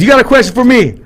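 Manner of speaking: high, loud comeback question; Brad. Retold or imitated. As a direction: imitate a buddy batting the question back. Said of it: "He goes".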